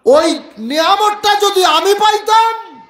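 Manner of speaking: high, loud preacher's wail of longing; impassioned cry. Retold or imitated. Weeping Borak's longing, cried out. (আকুল হয়ে উঁচু গলায় আর্তি)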